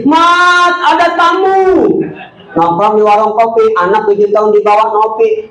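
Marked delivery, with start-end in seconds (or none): 0.0-1.9 mock shout from the wife; wife's yell. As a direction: yell for Mamat like a nagging wife. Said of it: "teriak tuh bini".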